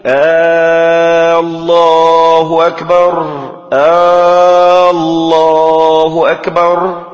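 slow muezzin chant with a long "aaa" before each الله; questioning hamza error. Shown as a demonstration. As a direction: stretch the opening vowel of الله, sung. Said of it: "بهمز استفهامي".

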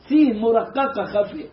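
usual speaking voice

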